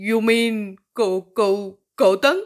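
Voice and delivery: slow, halting "కౌ-, కౌ-, కౌతాంగ్" with తా pressed hard and loud; stammered stress on తా. A narrator's reading. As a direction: stutter on కౌ then hit the తా of "కౌతాంగ్" hard. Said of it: "టా అనే అక్షరం ఒత్తి పలుకుతూ". ("కౌతాంగ్"లో తా అక్షరాన్ని ఒత్తి, తడబడుతూ, నెమ్మదిగా)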